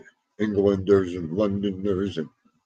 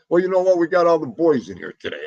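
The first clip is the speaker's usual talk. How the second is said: higher, louder put-on tough guy; mock guy voice; 7 semitones higher, 5 dB louder, faster than usual. Streamer mimicking a chatter's way of talking, higher and louder. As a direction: puff up like one of the boys. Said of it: "I could talk like this".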